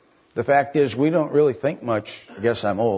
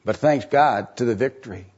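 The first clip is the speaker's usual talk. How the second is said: usual speaking voice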